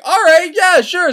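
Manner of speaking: lifted, airy okay; carefree soldiers agreeing to an odd plan; mock breezy agreement. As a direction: shrug it off in a high voice.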